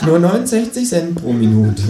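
high cheeky kangaroo. Acted. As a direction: answer like the cocky kangaroo.

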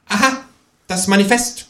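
high, precocious kangaroo voice; know-it-all. A narrator's reading. Kangaroo recognising the Manifesto, high and precocious. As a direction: state it like a little smart aleck. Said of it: "konstatiert das Känguru altklug".

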